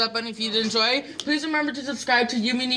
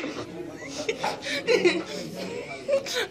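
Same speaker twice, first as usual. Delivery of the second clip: long high, softer laugh; 12 semitones higher, 5 dB quieter than usual. Real, not acted. Host hears the player's excuse, laughing. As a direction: giggle helplessly at length.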